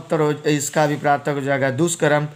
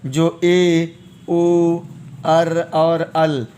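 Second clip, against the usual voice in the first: slow teaching delivery; much slower than usual. Shown as a demonstration. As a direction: stretch each sound for students to catch. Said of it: "teacher spelling out sounds slowly".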